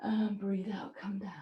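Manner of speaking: breathy whisper, barely voiced. Real, not acted. Yoga teacher guiding the class's exhale, in a whisper. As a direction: hush the cue so the class can settle.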